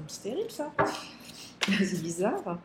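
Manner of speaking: jittery from coffee; shaky, wired voice from caffeine; too much caffeine. Real, not acted. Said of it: "J'ai bu trop de café". (voix tremblante, trop de café)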